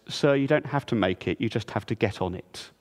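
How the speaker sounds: a calm deadpan from the ticket clerk. Retold or imitated. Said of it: "Very calmly says".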